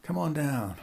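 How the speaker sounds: light, sing-song invite; breezy lure; mock welcome. [name] repeating his fake invite, breezy. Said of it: "I'll say".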